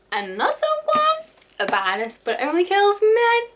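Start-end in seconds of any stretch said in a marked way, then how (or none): 0.1-1.3 squeaky high disbelief; high-pitched fake surprise; mock shock. 2.2-3.4 high, loud indignant squeal; hammed-up high indignation; mock outrage.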